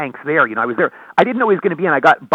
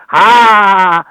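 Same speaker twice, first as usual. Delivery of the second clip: one long, loud "ha"; big, drawn-out one-burst cackle; signature comic laugh; 3 semitones higher, 9 dB louder than usual. Shown as a demonstration. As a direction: belt out a single held comedy laugh. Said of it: "the one laugh always would get laughs".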